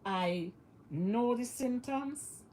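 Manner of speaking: ordinary talk, nothing marked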